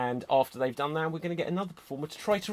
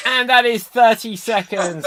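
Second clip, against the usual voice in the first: loud time call; 8 semitones higher, 12 dB louder than usual. Real, not acted. Host calling time on the improviser, loud.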